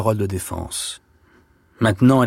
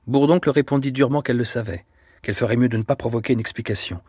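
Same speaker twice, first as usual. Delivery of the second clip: ordinary delivery, nothing marked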